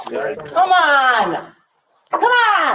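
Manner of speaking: loud, high-pitched yell of protest; real yelling. Real, not acted. Woman being detained yells at the officers, yelling. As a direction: yell it out, drawing out the vowel. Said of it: "Stop yelling".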